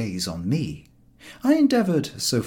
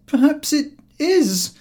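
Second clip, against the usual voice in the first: slow, high, louder; weary admission; 8 dB louder, slower than usual. A narrator's reading. George conceding to Harris, defeated. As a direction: give in with a groan of defeat. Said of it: "answered George".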